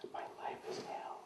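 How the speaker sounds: a rueful whispered confession; whispering to himself that his life is hell; whispered aside. Real, not acted. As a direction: whisper it under the breath. Self-pitying aside.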